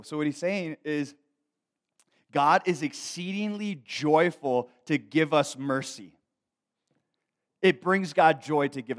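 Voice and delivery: loud, high pulpit proclamation; emphatic, measured, full-voiced sermon; forceful preaching. Real, not acted. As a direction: drive each phrase out to the congregation. Preaches on the verse.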